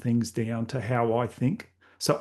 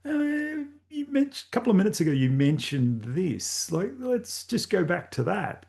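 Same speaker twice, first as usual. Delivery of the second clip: eager interrupter; 8 semitones higher than usual. Retold or imitated. Listener cutting in on a speaker, high and eager. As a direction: pipe up high to steer back to an earlier point. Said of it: "you'd be like".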